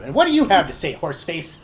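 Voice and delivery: high, loud gnome retort; glaring gnome's sharp comeback; snippy gnome. Acted. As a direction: throw the insult out high, then drop off. Glaring gnome insults a player.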